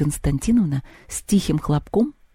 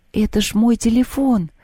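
exclamation; 6 semitones higher than usual. A narrator's reading. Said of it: "вскрикнула Маша".

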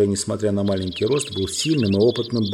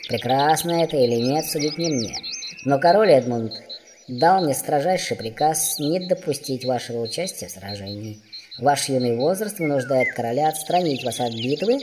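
higher, put-on character voice; formal dwarf; 4 semitones higher than usual. A narrator's reading. Dwarf addresses the young prince, formal. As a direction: address royalty stiffly as a dwarf.

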